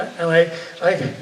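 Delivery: usual speaking voice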